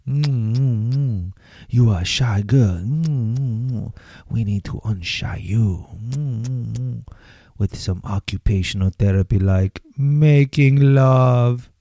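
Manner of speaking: amorous French skunk croon, slow and purring; Pepe Le Pew. Pretend. Host riffing as a cartoon skunk wooing a shy girl. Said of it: "That's an old Pepe Le Pew line".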